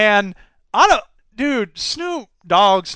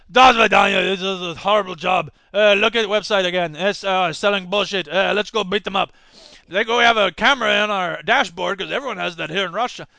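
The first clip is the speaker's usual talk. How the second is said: put-on Yakov Smirnoff Russian accent. Russian cop complaining about his job, in a hammy Russian accent. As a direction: play a Russian cop grumbling in a mock Russian accent. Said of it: "Why are you talking like Yakov Smirnoff".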